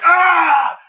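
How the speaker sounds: hammed-up monster war cry; mock battle yell. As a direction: roar as you charge in. Retelling monster fight.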